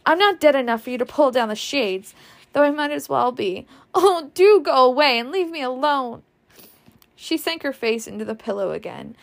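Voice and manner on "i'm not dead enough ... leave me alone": angry outburst. Acted. Scarlett lashing out from pillow.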